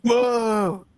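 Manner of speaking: high, stretched California "whoa"; dopey California dude going full throttle; full-throttle California accent. Acted. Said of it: "go full throttle with your California accent".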